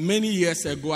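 ordinary talk

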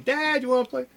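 put-on high, loud little-kid plea; high-pitched imitation of an eager kid; imitating eager child. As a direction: burst in like an excited small daughter. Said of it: "So she'd come in".

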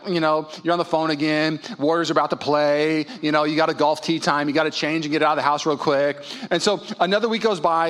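usual speaking voice

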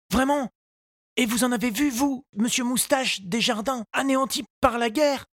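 put-on high, polite little boy's voice; a small boy asking politely; young boy Tistou. A narrator's reading. Tistou asks the gardener, high child voice.

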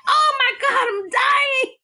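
high, drawn-out panicked wail; mock freak-out. Retelling Carrie freaking out in the shower, high mock-panicked wail. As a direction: wail it like you're melodramatically freaking out. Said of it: "she freaks out".

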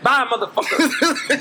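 loud, high, laughing brush-off; high, loud laughing send-off; mock hang-up. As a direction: blurt a cheeky goodbye as you slam the phone. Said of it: "he probably throw the phone down and be like".

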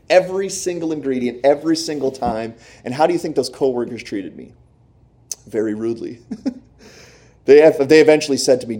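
ordinary talk

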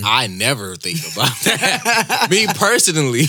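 real laughter breaking into the words; laughing. Real, not acted. Co-host denying ever thinking about that, laughing.

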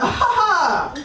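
imitated high belly laugh; big hefty laugh. Laughing at her peeing friend, big mock laugh. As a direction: act out a hearty laugh from the diaphragm. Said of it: "I had a really big".

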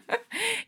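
light laugh. Real, not acted. Host laughs after thanks.

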